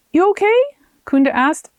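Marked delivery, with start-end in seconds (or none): 0.1-0.6 high, loud worried check-in; concerned friend. Acted. Kunda hears Arona wince and asks, high and concerned. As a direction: ask with sudden concern.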